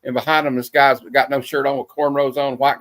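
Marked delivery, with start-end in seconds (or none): none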